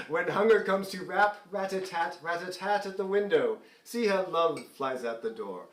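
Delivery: spoken recitation of lyrics in a light British accent; lightly put-on British accent. Shown as a demonstration. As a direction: read the lyric aloud with a mild British lilt. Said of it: "Why did you do that in a British accent?".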